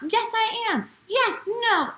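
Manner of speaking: put-on high childish insistence, half playful; squabbling disciple. Disciple arguing he is most important, childish squabble. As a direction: bicker like a kid who won't back down.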